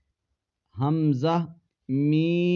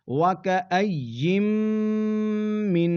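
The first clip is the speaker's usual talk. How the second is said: drawn Quranic recitation with a pause; slow Quran recitation; 5 semitones higher than usual. Shown as a demonstration. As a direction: recite word by word. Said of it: "tajweed reading demo".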